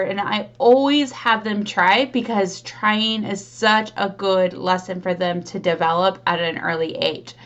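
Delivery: ordinary delivery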